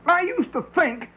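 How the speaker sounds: high, outraged mock voice; hammed-up shocked conservative; indignant right winger. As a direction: squeak it like an appalled patriot. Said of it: "he says".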